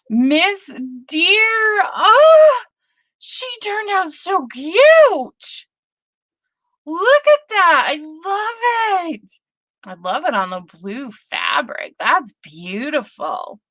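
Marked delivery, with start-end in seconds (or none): 0.1-9.3 high, slow, squealing delight over something cute; gushing excitement. Real, not acted. Gushing to a viewer over her cute deer, high and excited. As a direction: coo over it, pitched way up.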